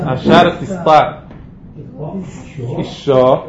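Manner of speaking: slow model reading, אישו with doubled sh; dagesh in the shin. Shown as a demonstration. Teacher modelling for a student, careful doubled shin in אישו. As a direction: spell out אשר תסתא slowly, then hold the ש in אישו. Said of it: "לא שמעתי את הדגש בשין".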